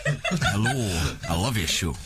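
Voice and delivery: put-on low, laughing stab at an American accent; cracking up mid-impression of an American actor; a second clumsy American accent take; bad American accent. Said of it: "how many times have I told you not to attempt accents".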